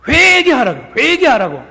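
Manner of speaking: put-on the old monk snaps at the abbot; angry shout. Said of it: "화를 내더래".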